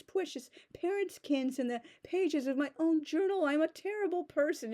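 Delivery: high, overwrought mock remorse; mock melodramatic guilt. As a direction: ham up a guilt-ridden teen. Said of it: "and just".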